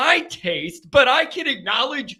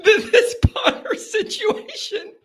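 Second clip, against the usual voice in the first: high, squeezed talk through laughter; cracking up; 9 semitones higher than usual. Real, not acted. Host lost over the punter situation, helpless laughing. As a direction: fight to get words out while laughing.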